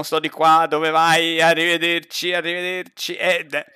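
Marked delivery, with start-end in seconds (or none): none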